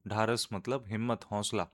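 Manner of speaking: ordinary delivery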